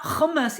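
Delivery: hummus with a throat-scraping kh at the start; over-guttural mispronunciation. Shown as a demonstration. Cook warning against getting all guttural, raspy kh. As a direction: say hummus as KHUM-mus. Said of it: "don't have to get all guttural".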